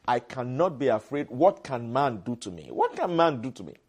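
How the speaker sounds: ordinary delivery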